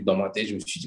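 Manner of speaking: usual speaking voice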